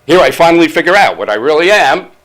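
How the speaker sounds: loud, high-pitched complaint; exasperated outburst. Acted. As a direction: burst out in indignation. Complains of being replaced as typewriter.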